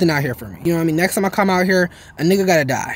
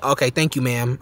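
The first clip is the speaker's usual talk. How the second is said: much lower, deepened voice; bass in the voice; 13 semitones lower, 5 dB quieter than usual. Retold or imitated. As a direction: drop the voice down low and heavy. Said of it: "I had to put some bass in my voice".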